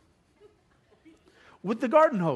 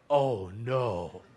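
dread; 11 semitones lower, 6 dB quieter than usual. Retold or imitated. Realizing he may be poisoned.

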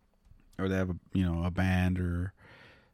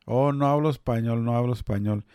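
higher-pitched teasing imitation of a denial; mock protest; 4 semitones higher, 3 dB louder, faster than usual. Friend teasing him about his claimed lack of Spanish, mock protest. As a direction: mimic someone's protest, starting high and easing down.

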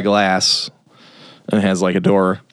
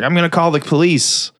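higher-pitched mock-angry threat; mad; 6 semitones higher than usual. Angry owner to the men he locked in, mock mad. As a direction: imitate a worked-up shop owner. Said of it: "Milich is mad".

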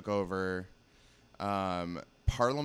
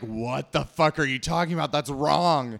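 loud, higher-pitched mock outrage; outraged retort; 5 semitones higher, 9 dB louder than usual. Retelling how everyone reacted to the accent claim, loud outrage. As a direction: imitate a crowd loudly objecting. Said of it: "everyone was like".

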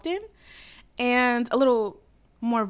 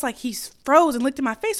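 ordinary delivery

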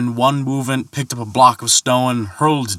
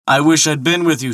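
eager shout; 7 semitones higher, 3 dB louder, faster than usual. A narrator's reading. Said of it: "shouted Thor eagerly".

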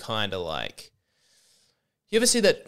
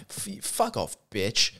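mock teen girl; 3 dB quieter, slower than usual. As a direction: toss it off like a bratty teen girl. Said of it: "hear one of them say".

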